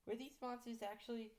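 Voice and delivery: high, slightly hushed inner questioning; wondering to oneself. Acted. New teacher doubts Monster High, wondering to herself. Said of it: "I thought to myself".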